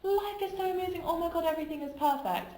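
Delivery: high, gushing burst of euphoria; exaggerated life-is-wonderful high; mock elation. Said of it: "Having that big swing of".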